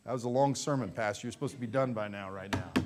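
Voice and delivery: low, quiet devil's jeer under the breath; soft, low taunt from the devil; mocking devil. Devil needling the pastor over a long sermon, low mocking voice. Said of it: "see, he's laughing like".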